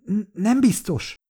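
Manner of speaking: high, stuttering hesitation; nervous stammer. A narrator's reading. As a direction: stammer it out, unsure. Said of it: "dadogta Likuva". (magas hangon, dadogva)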